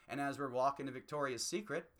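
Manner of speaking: normal talking voice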